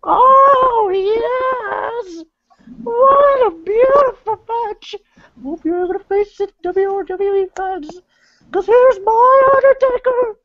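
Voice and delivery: piercingly high, wailing manager impression; Paul Bearer. Manager hyping the Undertaker to fans, high theatrical wail. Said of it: "How's my Paul Bear impression".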